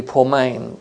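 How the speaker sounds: ordinary talk, nothing marked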